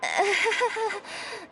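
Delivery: short embarrassed chuckle; sheepish laugh. Acted. Courier laughing off the invitation to play cards, sheepish. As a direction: laugh it off nervously.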